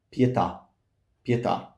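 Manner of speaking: "Pietà" as pye-TA; glide demo. Shown as a demonstration. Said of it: "With a glide".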